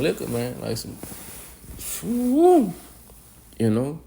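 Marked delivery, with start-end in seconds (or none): 2.0-2.7 whoop. Real, not acted. Likening it to strong liquor.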